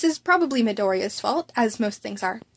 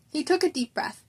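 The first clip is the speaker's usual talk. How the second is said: ordinary delivery, nothing marked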